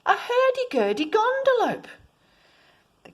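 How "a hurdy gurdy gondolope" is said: high, loud called answer; children calling back. Acted. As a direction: call it back up to the ship. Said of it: "the children called back".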